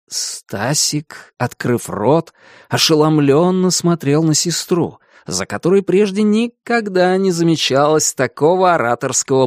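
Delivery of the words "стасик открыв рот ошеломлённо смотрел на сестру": usual speaking voice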